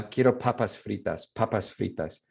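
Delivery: gringo accent with airy Ps in papas; Spanish in an American accent, explosive p; English-accented Spanish. Shown as a demonstration. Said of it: "is more air coming out".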